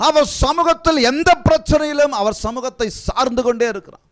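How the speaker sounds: high, loud impassioned preaching; fervent preaching. Acted. Preacher urging the congregation at full force, high and loud.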